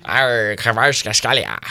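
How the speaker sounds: growled pirate "Arr", stretched slowly. Pretend. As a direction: say it like a hammed-up pirate. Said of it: "You sound like a pirate".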